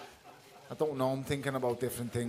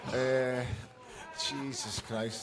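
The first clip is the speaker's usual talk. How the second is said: loud words broken by laughter, slow at the start; real disbelieving laughter; laughing; 3 dB louder than usual. Real, not acted. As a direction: still cracking up after the heckle. Laughing after the interruption.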